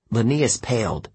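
ordinary delivery

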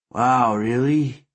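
put-on faint drunken wonder; dazed drunk. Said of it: "smiling faintly".

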